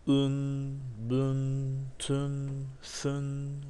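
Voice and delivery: each syllable held long on one high, level note; measured recitation of Arabic letter sounds; pronunciation drill. Shown as a demonstration. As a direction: intone the tanwin letters one by one, sustained. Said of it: "O harfleri bir telaffuz edelim".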